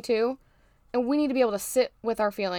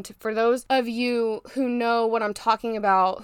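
ordinary delivery

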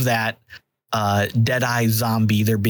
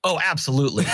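normal talking voice

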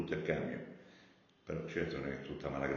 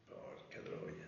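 whispered curse; 14 dB quieter, much slower than usual. Real, not acted. Facing mountains of garbage.

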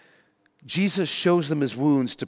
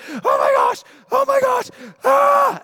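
hammed-up shrieks, loud and very high; panicked screaming; 6 dB louder than usual. Disciples diving behind sofas, acted panicked screams. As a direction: scream as if losing your mind, comically. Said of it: "They're just losing their minds".

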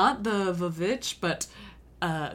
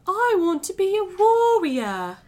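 cartoon boy voice; 10 semitones higher, 5 dB louder than usual. Voicing Taryn the boy.

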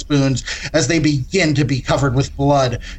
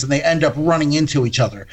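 usual speaking voice

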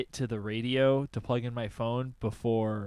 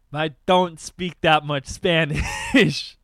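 shouted; 10 dB louder than usual. Real, not acted. Laughing outburst.

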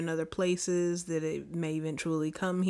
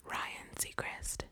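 slow drawn-out whisper; 16 dB quieter, much slower than usual. Pretend. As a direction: whisper the punchline slowly. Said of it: "it's a secret".

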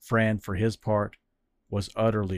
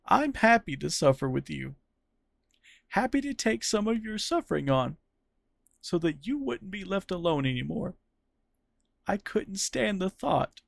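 Fran tender and tearful; 9 semitones higher than usual. Acted. Fran telling Cyril he'll share his suffering, tender. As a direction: say it through tears and a smile.

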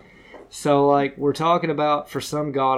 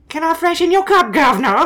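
much higher and louder Cockney servant; mock Cockney; 14 semitones higher, 6 dB louder than usual. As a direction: chirp it like a London servant. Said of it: "It would have been like".

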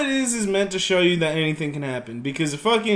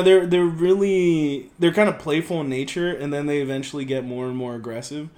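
normal talking voice

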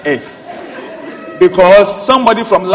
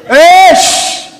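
loud hush; 8 semitones higher, 6 dB louder than usual. Real, not acted. Preacher hushing chattering children, loud. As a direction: hush them loudly, pitched up.

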